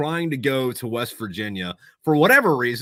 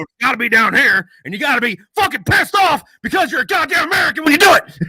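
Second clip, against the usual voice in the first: much higher, much louder pissed-off yell; diaphragm shout; 10 dB louder than usual. Shown as a demonstration. Coaching his host how to yell, a furious shout from the diaphragm. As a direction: bellow it from the gut like an angry American. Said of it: "you kinda gotta stream from your diaphragm".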